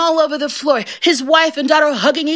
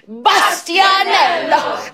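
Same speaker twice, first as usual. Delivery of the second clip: slow wailing cry of the name, less high; a long, mournful call of Bastianello; drawn-out wail; 6 semitones higher, much slower than usual. Acted. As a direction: stretch the name into a lament. Grocer wails the name.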